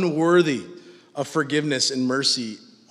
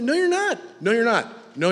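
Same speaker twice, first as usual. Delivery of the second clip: usual speaking voice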